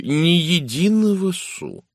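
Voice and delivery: high, slow, drawn-out refusal; indignant, pitch soaring, every word stretched; impatient refusal. Acted. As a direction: wave the offer off emphatically, stretching the words. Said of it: "Пуаро нетерпеливо помахал рукой".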